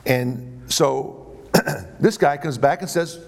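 ordinary talk, nothing marked

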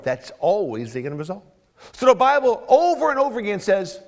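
rising to a loud, fervent sermon climb; preaching. Acted. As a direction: build the sermon to a peak. Preacher building to full force.

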